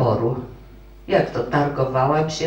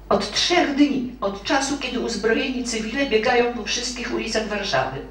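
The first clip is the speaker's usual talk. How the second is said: high, fast-building indignant plea; a young woman pleading her case, pitched high; heated protest; 8 semitones higher than usual. Acted. Young clerk protesting to the marshal, high and indignant.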